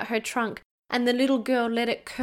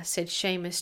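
ordinary delivery, nothing marked

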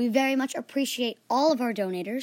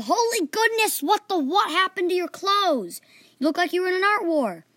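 shocked teacher; 8 semitones higher, 5 dB louder than usual. A narrator's reading. Teacher sees paint-covered class, high and loud in shock. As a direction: gasp it out, scandalized.

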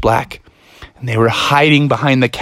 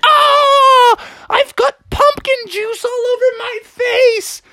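very high cartoon wail; distressed frog; slower than usual. Froggy hit by an exploding pumpkin, high dismayed shriek. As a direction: scream as if splattered in the face.

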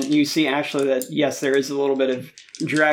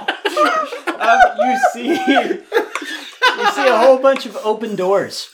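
laughing; 9 semitones higher, 4 dB louder, much slower than usual. Real, not acted. GM laughing while narrating.

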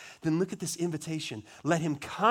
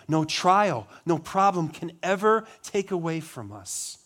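ordinary delivery

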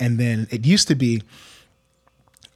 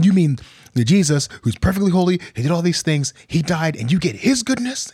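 leading questions; 6 semitones higher than usual. Pretend. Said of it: "I start asking all these questions".

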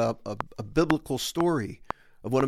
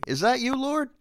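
much higher, searching Peter voice; Peter asking, unsure; 11 semitones higher than usual. Acted. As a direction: call out uncertainly, high and questioning. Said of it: "somebody trying to discern".

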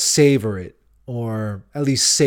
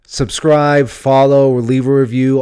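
louder, punchy list of asks; much louder podcast outro push; loud sign-off; 9 dB louder than usual. Real, not acted. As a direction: hype up the call to action. Outro call to action.

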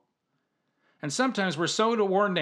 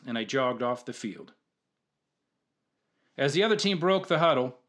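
ordinary talk, nothing marked

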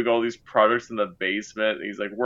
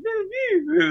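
mock chirpy answer.

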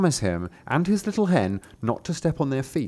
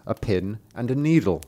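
ordinary delivery

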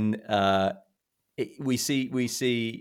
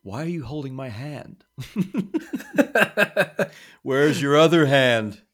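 quoting Del; 5 semitones higher, 5 dB louder than usual. Retold or imitated. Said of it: "He's like".

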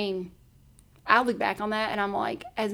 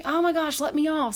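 higher, urgent plea; alarmed; 6 semitones higher, 4 dB louder than usual. Retold or imitated. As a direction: blurt it in a panic. Realising she missed her stop.

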